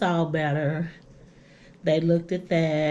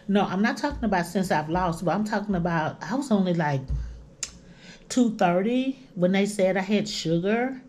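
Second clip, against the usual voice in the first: ordinary talk, nothing marked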